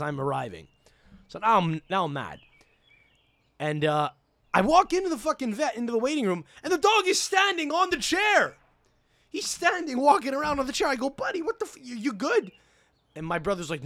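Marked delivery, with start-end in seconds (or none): 4.5-12.5 high-pitched, incredulous storytelling; astonished. Retold or imitated. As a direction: pitch soars in amazement at the sight. Astonished retelling of dog standing.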